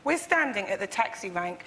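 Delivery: ordinary talk, nothing marked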